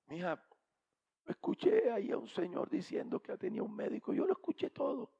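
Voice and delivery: a hint of a bedridden man calling; sick old husband. Sick husband calling his wife, lightly voiced old man. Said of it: "el viejo llama desde la, la habitación".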